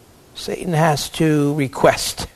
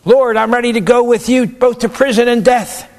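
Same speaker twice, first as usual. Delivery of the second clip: high, loud eager boast of a proud disciple; overconfident Peter; 7 semitones higher, 6 dB louder than usual. Acted. Peter to Jesus, swearing loyalty with eager bravado. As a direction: puff up like Peter promising too much.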